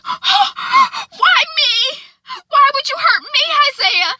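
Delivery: high, loud, tearful wail after the push; crying out. Acted. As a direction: cry out, hurt and pleading. Zora after being pushed.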